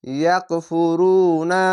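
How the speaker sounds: ইয়াকফুরুনা recited very slowly, রু held long; Quranic recitation. Shown as a demonstration. Teacher models the long vowel for pupils. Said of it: "এক আলিফ টেনে পড়বেন".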